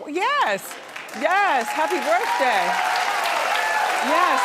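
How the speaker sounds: loud, high cheering yes; excited cheer. Real, not acted. Host cheering the birthday couple, loud and excited. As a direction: whoop it up for the birthday guests. Greeting birthday audience members.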